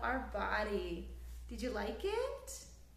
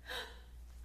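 gasp; 8 dB quieter than usual. Acted. Storyteller gasps before page.

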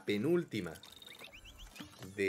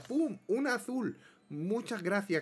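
excited burst; 10 semitones higher, slower than usual. Real, not acted. Reacting to a reward draw.

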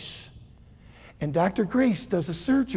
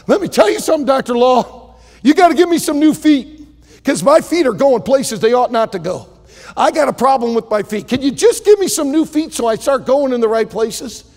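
urgent plea; 6 semitones higher, 6 dB louder than usual. Acted. Lester begs for new feet.

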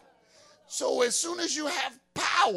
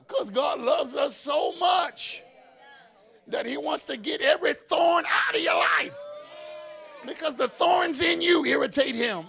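crying-out gospel climax; full-force preaching; 7 semitones higher, 6 dB louder than usual. Acted. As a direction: drive it home to the church.

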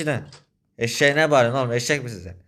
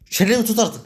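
high, loud "shut your mouth"; angry order; 8 semitones higher, 4 dB louder than usual. Acted. Furious at the friend who confessed everything, shouting. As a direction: shout him down.

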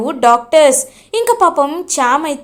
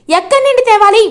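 higher, louder desperate repeat; desperate cry; 6 semitones higher, 4 dB louder, faster than usual. A narrator's reading. Chamanthi begs her sister, louder desperate repeat. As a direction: cry out the question in despair.

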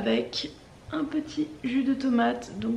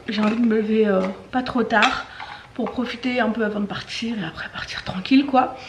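ordinary talk

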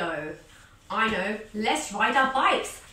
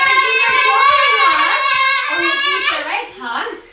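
much higher, louder playful character voice; friends' voice; 11 semitones higher, 12 dB louder than usual. Pretend. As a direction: answer back as the friendly animals. High voice thanking, as friends.

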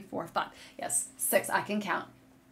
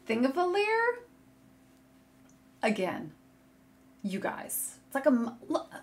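ordinary talk

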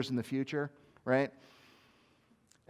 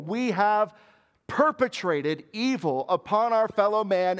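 higher and louder, driving each clause home; fervent preaching; 5 semitones higher, 4 dB louder than usual. Acted. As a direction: preach it with rising conviction. Passionate sermon climax, raised.

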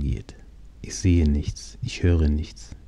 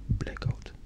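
a breathy, very soft whisper; whispered fade-out; 10 dB quieter than usual. Acted. Narrator losing consciousness after the crash, whispering. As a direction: let the word die away into nothing.

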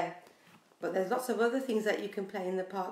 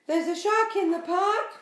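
shout; 10 semitones higher, 6 dB louder than usual. Acted. Timothy shouts again.

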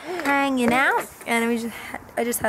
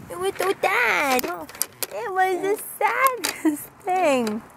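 pitying coo; 6 semitones higher, slower than usual. Real, not acted. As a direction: coo with amused pity. Pitying her scared baby.

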